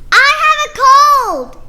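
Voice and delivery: high, loud happy cry; excited announcement. Acted. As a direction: shout the good news joyfully. Gerald tells Piggie the news.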